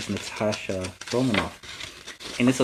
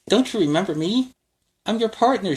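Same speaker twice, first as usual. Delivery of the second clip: pleading; 8 semitones higher than usual. Acted.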